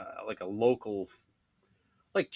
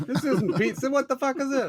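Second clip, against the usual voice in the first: angry Italian; 6 dB louder than usual. Retold or imitated. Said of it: "they all just start cursing at me".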